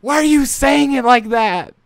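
exasperated disbelief. Real, not acted. Protesting a mispronunciation.

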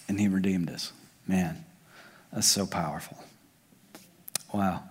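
soft, low, awed murmur; quiet amazed hush; hushed awe. Real, not acted. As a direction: let the wonder drop the voice right down. Awed hush after redemption point.

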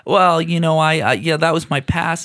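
high, loud defensive voice; defensive actor voice. Retold or imitated. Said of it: "he's like".

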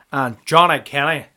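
lightly done Northern Irish commentator drone; mild Northern Irish commentator impression. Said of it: "Every time I do the Nordy accent".

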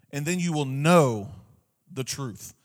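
KNOW held long and strong, pause after it; stress on KNOW. Shown as a demonstration. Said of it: "but I put it on".

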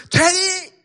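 high-pitched distant call. Retold or imitated. Friend calling the speaker from the lake, calling out. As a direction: call a friend over from afar.

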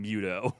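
"Muto" as MYOO-toh; mispronounced Muto. Shown as a demonstration. Host rejecting his old version, "Muto" as MYOO-toh. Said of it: "That sounds m-, more right than".

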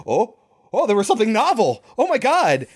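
high-pitched, excited gasp of discovery; retold thrilled reaction, voice jumping high; mock amazement. Retold delighted reaction to novelty.

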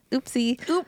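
flippant oopsie. Retold or imitated. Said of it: "that's not a good apology".

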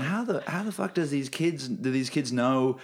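high, slow disbelieving question; mock outrage at the kids; incredulous inner voice. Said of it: "I'm sitting here going".

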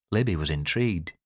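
ordinary delivery

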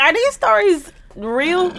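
high, slow, stretched-out disbelief; incredulous question. Retold or imitated. Recalling her reaction to the stories, incredulous. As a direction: ask it high and drawn out, doubting.

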